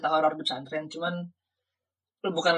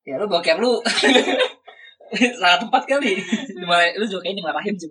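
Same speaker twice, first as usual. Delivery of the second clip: laughing; 3 semitones higher, 5 dB louder than usual. Real, not acted. Friend mocks the toilet story.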